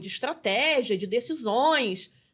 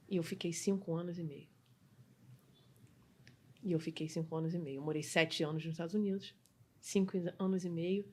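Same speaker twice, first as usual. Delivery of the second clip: real, understated emotion, softly spoken; soft and subdued, moved by the memory; emotional; 4 semitones lower, 7 dB quieter than usual. Real, not acted. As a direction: let it sink in quietly, holding feeling back. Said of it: "uma das coisas que me emociona".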